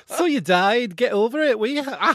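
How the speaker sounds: put-on loud, high, slipping into Scottish Northern English accent. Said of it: "That's Scottish, but okay".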